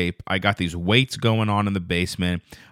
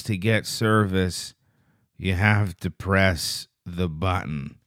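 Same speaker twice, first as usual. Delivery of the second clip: put-on slow, low, quiet monotone of a zoned-out kid; creepy kid's cryptic warning, drawn out; vacant troll kid; 4 semitones lower, 4 dB quieter, slower than usual. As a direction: intone it slowly and softly, staring into space. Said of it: "just staring into space".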